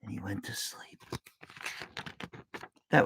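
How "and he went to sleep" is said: hushed bedtime whisper; soft unvoiced whisper as he drifts off; whispered narration. A narrator's reading. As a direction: whisper it so he stays asleep. Dragon falls asleep, narrated.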